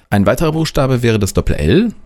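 usual speaking voice

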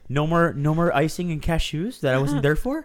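mock alarm at missing the snacks; playful panicked whine; voicing Nick's panic. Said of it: "the look, the fear on Nick's face right now is like".

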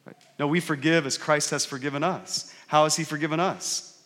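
ordinary talk

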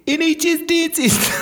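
very high, hammed-up bad Australian accent; exaggerated Australian accent. Embarrassed dentist hawking flyers, very high fake Australian accent. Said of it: "That's a bad Australian accent".

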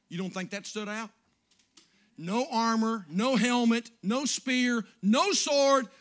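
full-throated sermon climb; preaching at full force. Acted. Preaching build-up, loud.

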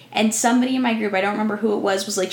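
ordinary talk, nothing marked

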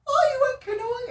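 loud, squeaky high mock of excited housemates; mocking imitation. Imitating people at the house greeting the paddler, mocking. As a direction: imitate nosy friends in falsetto.

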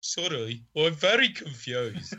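broad, exaggerated Brummie; sophisticated Brummie. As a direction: play the puzzled buyer in thick Brummie. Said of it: "I'm going sophisticated Brummie".

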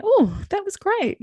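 high, slow, glowing "Oh, that was great"; a lighter, happier voice after the storm passes; delighted relief. Retold or imitated. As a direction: sing it out with a lift of relief. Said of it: "came out the other side and went".

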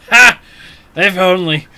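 loud bark of a laugh then quip; hearty captain's laugh; laughing retort. Acted. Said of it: "He laughs at that one".

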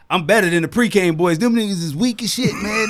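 loud, high cocky braggart; cocky rival boast. Retold or imitated. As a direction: brag like a cocky upstart. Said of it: "I got one podcast".

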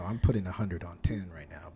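unvoiced confiding aside; whisper. Real, not acted. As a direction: whisper it like a secret.

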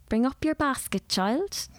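lightly voiced stern lady giving an order; commanding. A narrator's reading. Miss Dawson summons the girl to her house, lightly commanding. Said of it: "Miss Dawson commanded".

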